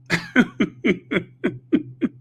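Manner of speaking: high staccato chuckle; laughing. Real, not acted. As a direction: laugh in a run of short high bursts. Laughs before mocking Aunt Bee.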